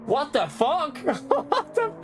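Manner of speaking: high, stunned squeal of disbelief; can't believe what just popped up in chat; shocked disbelief. Real, not acted. Streamer shocked by huge donation.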